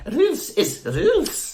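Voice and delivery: high, drawn-out mock Scottish accent. Co-host echoing the strict McGonagall, slow mock Scottish accent. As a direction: echo the catchphrase in slow comic Scottish. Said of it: "like, a Scottish accent".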